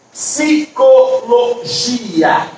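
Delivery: PSICOLOGIA very slowly, split as psi-co-lo-gi-a; separated syllables. Shown as a demonstration. Teacher showing how to separate syllables, very slow psicologia in pieces. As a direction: spell out psicologia syllable by syllable, gi apart from a. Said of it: "eu vou separando".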